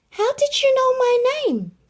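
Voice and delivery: Lady stunned that the stranger knows her name; astonished demand. Acted. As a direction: gasp it out in sheer astonishment. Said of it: "demanded Lady, astonished".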